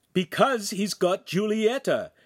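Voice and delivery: high, slow upset reply; emotional. Acted. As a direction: let the worry crack through. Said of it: "Handsome said emotionally".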